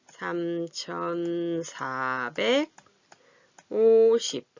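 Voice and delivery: very slow, each syllable stretched; drawn out while writing. Real, not acted. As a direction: say it as you write each digit. Writing the number while saying it.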